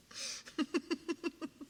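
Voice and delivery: high-pitched run of giggles; giggling. Real, not acted. Streamer who apologizes for being so giggly, giggling. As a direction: keep giggling, unable to stop. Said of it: "I am very giggly".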